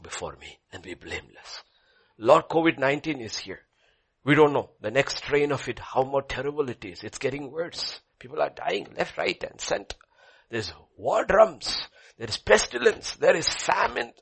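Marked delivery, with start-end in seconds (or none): 2.2-14.1 worried believer. Acted. Believer lists fears to God.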